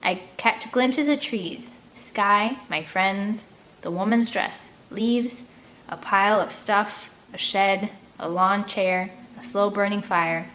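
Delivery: ordinary delivery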